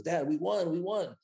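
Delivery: high-pitched imitation of an excited daughter; retold excitement. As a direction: lightly mimic her thrilled announcement. Retelling daughter's excited auction win.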